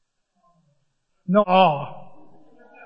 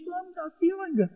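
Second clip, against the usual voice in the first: sick whimper; 9 semitones higher, 10 dB quieter than usual. Acted. Acting sick whine.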